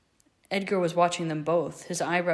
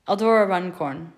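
plain reading voice

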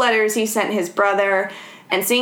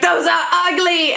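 higher-pitched mock teacher's verdict; French teacher; 7 semitones higher than usual. French teacher judging the painter's roots, high mock-disapproving voice. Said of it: "the French teacher's like".

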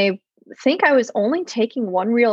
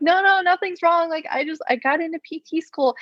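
higher-pitched, hurried explaining; lightly acted, high-pitched reassurance; retold tearful reassurance; 7 semitones higher than usual. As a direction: imitate blurting good news through emotion. Retold reassurance to worried dad.

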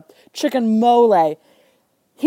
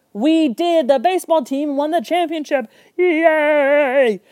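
excited cheer; 7 semitones higher, slower than usual. Retold or imitated. Team cheering their win.

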